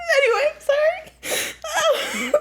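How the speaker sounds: very high, slow, squeaky with laughter; real helpless laughter. Real, not acted. Reader trying to get back to the post, laughing helplessly. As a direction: apologize while unable to stop laughing. Reader apologizes while cracking up.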